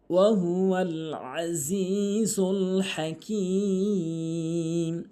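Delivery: slow, sustained chanting with long-held vowels; Quran recitation. Shown as a demonstration. Teacher opens the Quran lesson with a verse, slow melodic recitation. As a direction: recite it slowly and melodically, stretching each elongation.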